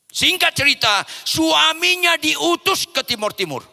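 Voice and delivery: high, loud preaching proclamation; forceful preaching. Acted. Preacher announcing husband's posting, high loud preaching. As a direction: proclaim the turn in the story. Preacher jumps the story forward.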